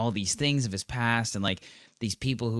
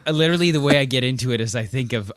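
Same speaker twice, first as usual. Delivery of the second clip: ordinary talk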